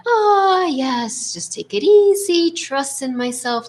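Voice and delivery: high, drawn-out, exaggerated serenity; mock blissful self-talk. Coach mimics client's imagined self-talk, mock-serene and high. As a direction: parody someone who is endlessly at ease. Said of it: "gonna just be like".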